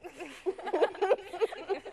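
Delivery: words tumbling fast through real laughter; laughing. Real, not acted. Host on live TV can't stop laughing, laughing. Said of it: "I don't know why I'm laughing so much".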